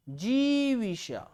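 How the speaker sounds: जीविषा stretched slowly syllable by syllable; slow spelling-out. Shown as a demonstration. Teacher showing how जिजीविषा is written, slowly. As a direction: draw out जीविषा for the class. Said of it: "जिजीविषा किस प्रकार लिखी जाती है".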